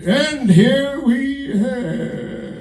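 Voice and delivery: very slow, high narrator dying mid-sentence; David Attenborough. Acted. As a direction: stretch each word out until the narration gives out. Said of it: "he's gonna be".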